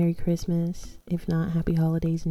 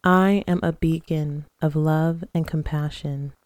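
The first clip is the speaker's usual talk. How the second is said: guided meditation. Acted. Leads guided breathing and affirmations.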